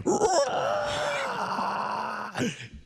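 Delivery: high screeching dinosaur roar. Retold or imitated. Dinosaur roar in picnic story.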